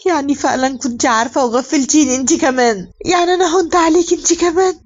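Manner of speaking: acted hurt cry, pitch climbing high on the second question; tearful reproach. A narrator's reading. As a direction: voice a wounded, tearful accusation. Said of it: "وسيهان بيعيط وبيقول لها". (عتاب باكي بصوت عالي الطبقة)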